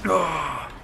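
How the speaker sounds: short, high mock war cry; Viking battle yell. Pretend Viking fights the beasts, hammed-up yell. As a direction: yell as if swinging at foes. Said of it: "that's my Viking voice".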